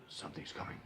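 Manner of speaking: a whispered gravel-free Batman growl. Retold or imitated. Retelling the trailer's last line, mock Batman whisper. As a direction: breathe it out like a hushed Batman. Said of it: "in a Batman voice".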